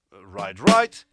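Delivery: "right, right" called out loud; loud demo. Shown as a demonstration. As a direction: bark out the wrong double.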